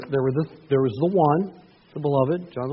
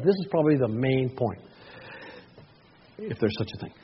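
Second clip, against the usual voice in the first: normal talking voice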